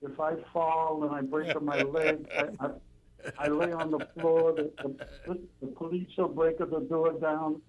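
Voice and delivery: put-on high, slow old Italian grandmother in broken English; slow, high Italian granny accent. Caller mimics an Italian grandmother to the host, high slow Italian accent. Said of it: "You, you do that in an Italian accent".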